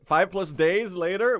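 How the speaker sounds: a streamer rereading chat's joke, stunned; incredulous reread. Real, not acted. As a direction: stretch it out like you can't believe it.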